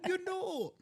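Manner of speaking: very high mock Jamaican lilt; Kamala accent. Co-host mimicking a politician for laughs, mock Jamaican. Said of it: "Anytime a Kamala accent comes out".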